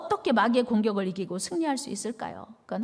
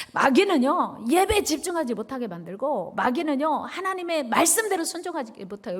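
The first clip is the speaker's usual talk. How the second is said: ordinary talk, nothing marked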